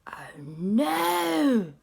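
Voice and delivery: loud, high, slow alarmed cry; frightened cry. Acted. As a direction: gasp it out in terror. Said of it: "They said".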